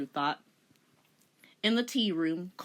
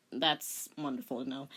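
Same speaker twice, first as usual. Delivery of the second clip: usual speaking voice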